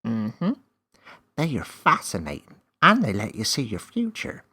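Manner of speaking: enticing mystery; elf voice. A narrator's reading.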